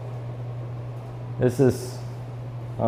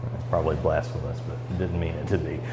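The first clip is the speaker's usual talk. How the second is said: sheepish aside; 7 semitones lower, 11 dB quieter than usual. Real, not acted. Sheepish aside after a joke.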